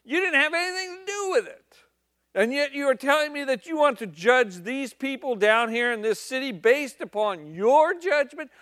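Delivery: loud stern scolding, rising in pitch; exasperated God. Acted. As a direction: dress down a whining prophet. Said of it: "God just".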